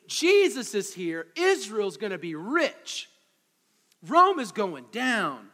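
loud, slow triumphant crowd cheer; crowd's triumphant hopes. Retold or imitated. Listeners dreaming of victory over Rome, loud and slow. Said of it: "they're thinking".

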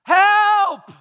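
very loud, high, drawn-out yell; shouting out. Acted. World without peace calling out, shouted cry. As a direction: scream for help with full force. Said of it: "Who are still shouting out".

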